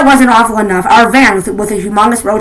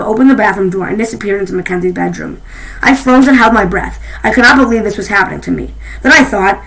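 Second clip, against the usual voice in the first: ordinary delivery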